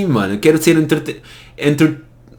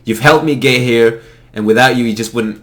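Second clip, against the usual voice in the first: normal talking voice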